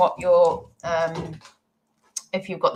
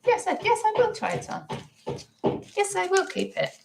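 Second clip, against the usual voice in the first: delighted high voice. Retold or imitated. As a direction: squeal with joy over a ring. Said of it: "did go to photograph to list and gone".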